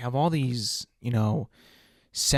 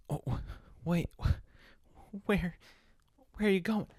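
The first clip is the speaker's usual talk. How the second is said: a stammering, rising surprise, pitched higher; startled friend; 6 semitones higher, 5 dB quieter, slower than usual. Acted. Friend stunned as buddy walks out, stammering higher. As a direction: catch him before he leaves, flustered. Friend shocked at sudden departure.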